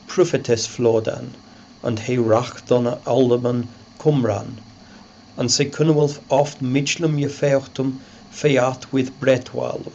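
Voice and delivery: put-on reading the Old English text aloud. Shown as a demonstration. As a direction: recite it in Old English pronunciation. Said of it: "The text".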